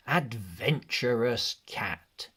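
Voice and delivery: ordinary delivery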